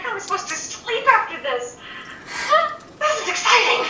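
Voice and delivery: high, laughing excitement; bubbling over with glee; excited. Acted. Young creature can't sleep, high and excited. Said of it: "He's like".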